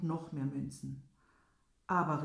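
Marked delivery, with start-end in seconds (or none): 1.9-2.2 a louder cry of dismay; loud outcry. Acted. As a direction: burst out with it, louder. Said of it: "rief er". (lauter Ausruf)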